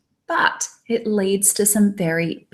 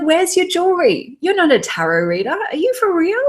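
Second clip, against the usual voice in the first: higher-pitched mock disbelief, jumping up on the questions; sceptical quizzing; 7 semitones higher than usual. Client doubting the tarot reader is real, mock incredulous quizzing. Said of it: "this woman immediately quizzed".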